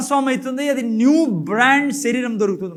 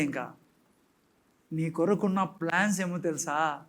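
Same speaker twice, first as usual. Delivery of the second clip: normal talking voice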